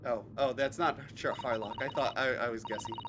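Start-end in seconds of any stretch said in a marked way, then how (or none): none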